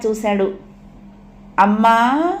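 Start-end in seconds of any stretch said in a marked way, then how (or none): 1.6-2.3 loud call with a long rising end; child's eager, raised call; calling out for mother. A narrator's reading. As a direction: call Amma, voice swinging upward. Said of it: "మళ్ళీ పిలిచాడు".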